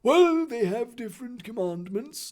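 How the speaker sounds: high put-on headmaster voice; well-meaning Dumbledore. Dumbledore explaining commandments to Harry, well-meaningly. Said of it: "Dumbledore explained well-meaningly".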